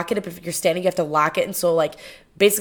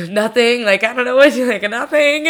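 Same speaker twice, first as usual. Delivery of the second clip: imitated breathlessness; 4 semitones higher than usual. Out-of-breath daughter covering up on a call to mom, mock winded. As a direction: gasp for air, then higher-pitched denial. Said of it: "I am literally huffing and puffing".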